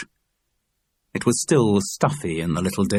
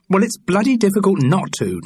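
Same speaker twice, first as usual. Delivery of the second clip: high, louder exasperated grumble; complaining; 11 semitones higher, 4 dB louder than usual. A narrator's reading. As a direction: protest it with exasperation. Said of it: "Charles Paris complained".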